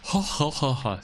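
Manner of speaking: a mock jolly ho ho ho; deliberate hearty ho ho laugh; English laugh "ho ho". Shown as a demonstration. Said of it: "Sorry, English laugh".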